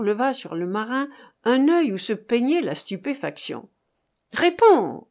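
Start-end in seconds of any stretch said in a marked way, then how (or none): 4.3-5.1 high, loud, forceful order; threatening demand. A narrator's reading. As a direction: burst out demanding an answer. Interrogator threatens with dagger. (ordre menaçant, aigu et fort)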